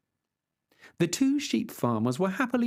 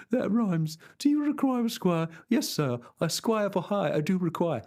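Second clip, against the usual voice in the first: higher, chirpy country lad voice; cheerful farmhand; 5 semitones higher than usual. Acted. Farmhand playing along with the rhyme, chirpy and higher. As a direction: play it light and eager, pitched up.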